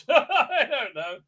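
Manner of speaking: high, slow laughing admission; amused, laughing shrug of a line; real laughing. Real, not acted. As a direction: let a laugh spill into the words. Laughs at their own guess.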